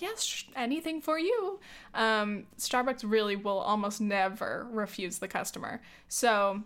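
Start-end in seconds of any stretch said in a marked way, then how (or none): 0.0-1.6 eager barista. Retold or imitated. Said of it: "And they're like".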